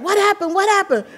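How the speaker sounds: high startled mom asking; imitating a mother rushing in at the crash; alarmed mom. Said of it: "So my mom rushes in".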